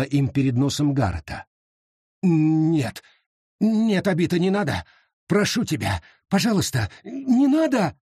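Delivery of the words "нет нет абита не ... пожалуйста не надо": climbing higher, frantic plea; desperate begging. Acted. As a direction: beg her to stop. Garrett begs Abita.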